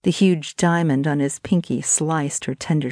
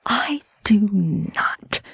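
high, breath-edged hiss; hissed denial; 4 semitones higher than usual. A narrator's reading. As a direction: hiss it back at him. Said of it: "she hissed".

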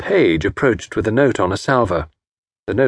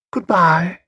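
vague; 8 semitones higher than usual. Acted. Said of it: "she said vaguely".

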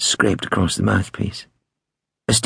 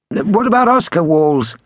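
high-pitched prank-call voice; prank caller; 9 semitones higher, 4 dB louder than usual. A narrator's reading. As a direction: ask innocently in a high put-on voice. Prank caller keeps asking.

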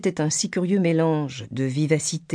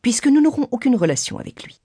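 high-pitched bitter retort; 8 semitones higher, 3 dB louder, faster than usual. A narrator's reading. Mother blames her husband for not calling on Bingley, bitter. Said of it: "répliqua la mère avec amertume".

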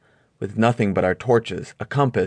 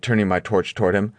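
plain reading voice

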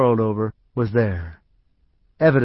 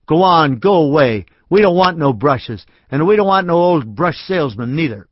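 put-on higher, louder child shouting; boy echoing the drunk man, shouting; bratty shout; 4 semitones higher, 5 dB louder than usual. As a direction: shoo him off like a brat. Boy mimics drunk, shooing George.

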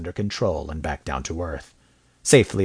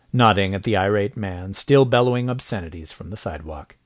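ordinary delivery

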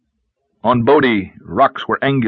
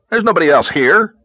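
higher, louder irritated retort; irritated at the old man's joke, higher and louder; irritable; 8 semitones higher, 4 dB louder than usual. A narrator's reading. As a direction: bite it off, fed up. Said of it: "he said irritably".

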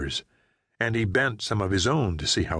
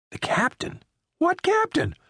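higher frightened driver demanding answers; alarmed Jerry. Acted. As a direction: press him, rising in alarm. Jerry stunned.

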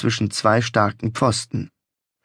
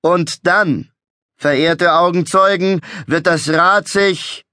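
raised, theatrical carnival barker; showman voice; 6 semitones higher, 3 dB louder, slower than usual. A narrator's reading. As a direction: proclaim it like a fairground showman. Showman building suspense.

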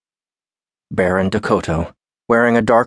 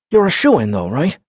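higher, upbeat reassurance; encouraging buddy; 7 semitones higher than usual. A narrator's reading. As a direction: cheer him on. Baron encouraging.

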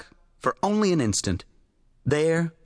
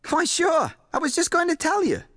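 eager; 13 semitones higher than usual. A narrator's reading. Writer caught out, eager.

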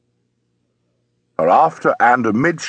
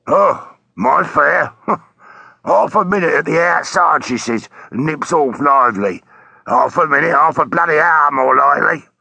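disgruntled taxi driver; 3 semitones higher than usual. Acted. Taxi driver telling a policeman about his missing fare, disgruntled. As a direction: complain like a put-out London cabbie.

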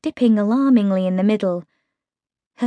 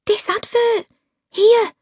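excited; 13 semitones higher than usual. A narrator's reading. Meg excited.

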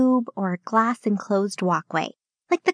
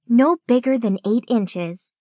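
ordinary delivery, nothing marked

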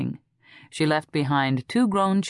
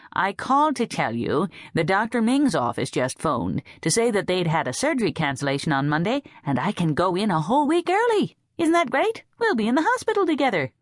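rising higher and faster, gushing with joy; excited; 6 semitones higher than usual. A narrator's reading. Rainey tells her cousin the surgery moved up, gushing excitedly. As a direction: pile on the good news, climbing to a squeal.

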